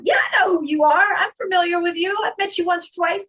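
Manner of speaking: high-pitched sarcastic comeback; retold scornful retort. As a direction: throw it back at him, unimpressed. Retelling her scornful retort.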